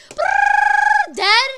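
high, loud mock gun rattle; spray the noise like an enemy; imitating gunfire. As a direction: kid mimicking enemies gunning down a teammate. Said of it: "they're like".

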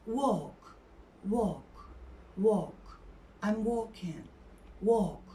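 "Walk" as "wook" with long aw, no l; silent l in walk. Shown as a demonstration. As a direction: lengthen the vowel of "Walk", drop the l. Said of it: "L yerine yumuşak G varmış gibi".

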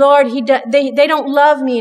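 complaining. Retold or imitated. Her complaint in the story.

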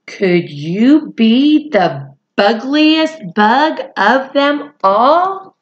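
slow, drawn-out title reading; slow, grand read-aloud to children; dramatic storybook read. A narrator's reading. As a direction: savor each word like a big reveal.

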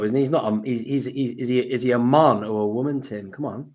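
ordinary talk, nothing marked

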